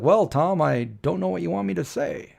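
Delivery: lightly voiced uncle on a home video; Uncle Bud. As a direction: imitate an uncle put on the spot, lightly. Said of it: "He's like".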